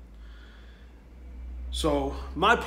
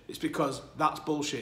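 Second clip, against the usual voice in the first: ordinary delivery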